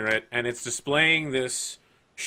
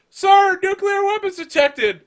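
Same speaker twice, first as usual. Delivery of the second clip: very high, loud screech of alarm; hammed-up sci-fi bridge officer yelling an alert; alarmed shout; 11 dB louder, slower than usual. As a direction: shriek the warning like a panicked crewman.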